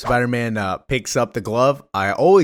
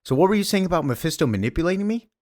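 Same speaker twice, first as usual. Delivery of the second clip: higher, fast, needling question; smug Thanos taunt; 8 semitones higher, faster than usual. Retold or imitated. Thanos gloating at Wolverine after beating the heroes, smug and fast. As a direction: rub the victory in his face. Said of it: "and he's like".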